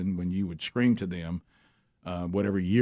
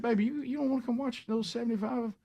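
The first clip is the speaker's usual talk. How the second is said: wheedling; 12 semitones higher, 4 dB quieter than usual. Retold or imitated. Husband coaxing uninterested wife.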